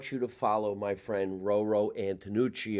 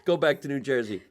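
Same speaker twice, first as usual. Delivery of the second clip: usual speaking voice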